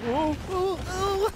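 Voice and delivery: high rising hoots; playful hooting. Pretend. Player goofing around before laughing, high playful hoots. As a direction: hoot it high, climbing each time.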